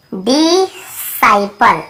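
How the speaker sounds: डिसाइपल stretched very slowly, di, then sai-pal; syllable-by-syllable pronunciation. Shown as a demonstration. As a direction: break डिसाइपल into slow parts, C silent. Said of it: "इसे हम क्या पढ़ते हैं?".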